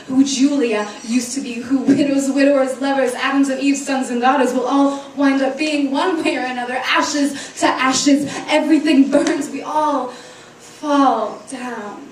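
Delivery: driving, loud list that slows at the end; forceful spoken-word crescendo; impassioned slam poetry. Acted. Poem builds to a climax.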